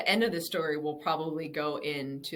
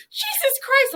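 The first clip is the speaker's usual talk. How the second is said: high, loud mock cry of shock; shocked outcry; 9 dB louder, slower than usual. Friend reacting to a hard slap, shocked. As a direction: yelp it like you've just been slapped.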